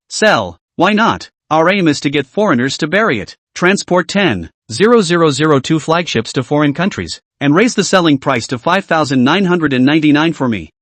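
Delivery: usual speaking voice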